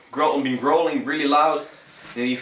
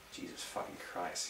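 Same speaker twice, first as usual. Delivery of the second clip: much softer breathy swear under the breath; muttered aside; 6 semitones lower, 18 dB quieter than usual. Real, not acted. Vlogger swearing quietly at a farting dog mid-video. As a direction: grumble it so the camera barely hears.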